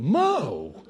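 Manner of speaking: mock exasperated cry of a name; retold wifely protest. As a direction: imitate a wife calling her husband out. Wife protesting at her husband.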